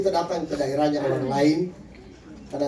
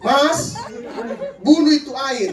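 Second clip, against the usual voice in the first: loud, high wife's yell; imitated shout, pitched well up; 8 semitones higher, 5 dB louder than usual. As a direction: bellow an order from the bedroom. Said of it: "Istrinya teriak lagi".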